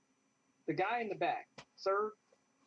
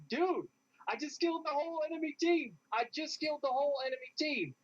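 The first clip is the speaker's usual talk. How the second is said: much higher, triumphant yell to his team; excited yelling; 10 semitones higher than usual. Retold or imitated. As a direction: shout the news like you can't believe your own win. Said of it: "And I was yelling".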